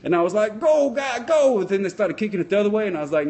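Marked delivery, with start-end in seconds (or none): 0.6-1.6 high retold fan cheer; urgent sideline cheering; excited cheer. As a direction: cheer the player on, pitched up.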